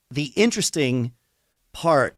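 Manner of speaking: usual speaking voice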